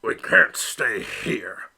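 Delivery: slow, heavy father duck voice; a weary papa, dragging the words; low-key stern father, slowed down; papa replying. Acted. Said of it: "Papa replied".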